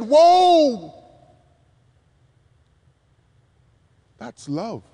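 a high, loud Whoa that sinks into a hushed that's love; awed whoa. Acted. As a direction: gasp in wonder, then breathe the answer. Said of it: "and they said".

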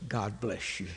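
very soft, low blessing; calm wife. Retold or imitated. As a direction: answer gently, low and unruffled. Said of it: "She just is the calmest person".

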